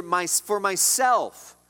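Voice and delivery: "for myself" drawn out very slowly, each word weighted; stress on myself. Shown as a demonstration. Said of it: "the emphasis here".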